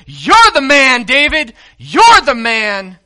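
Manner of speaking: loud, high accusing cry, slowing on the last line; cries out. Retold or imitated. Prophet Nathan confronting King David with his sin, crying out loud. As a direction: hurl it at him like a prophet's verdict. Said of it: "cries out and says".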